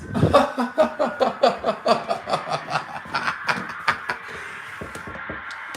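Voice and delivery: long giddy laugh; breathy laughter. Real, not acted. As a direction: laugh out of joy. After the cheering.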